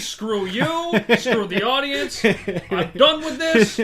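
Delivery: loud, slow fake rage; mock angry outburst. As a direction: storm off in a huff. Mock storming off podcast.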